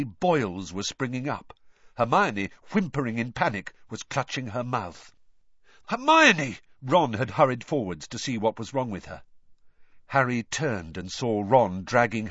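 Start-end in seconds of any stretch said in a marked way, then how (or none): 5.9-6.6 high, loud alarmed call; alarmed cry. A narrator's reading. Ron seeing Hermione hurt, high loud alarmed cry. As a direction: rush to her in alarm.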